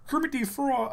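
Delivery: high puppet frog voice; Kermit. Acted. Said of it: "doing a certain accent or a voice".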